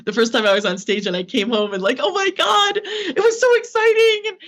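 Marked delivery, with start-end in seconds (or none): 2.0-4.4 high, squealing rush of joy, replaying it; gushing excitement. Retelling coming home from her first stage show, squealing excitement. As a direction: gush like you just came off stage.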